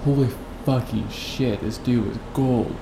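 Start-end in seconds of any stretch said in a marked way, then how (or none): none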